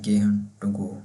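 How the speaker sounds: "gehen" said very slowly, each sound drawn out; "gehen" stretched out gently for learners; slow demonstration. Shown as a demonstration. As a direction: spell out "gehen" slowly for the class. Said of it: "उसको हम कहते हैं".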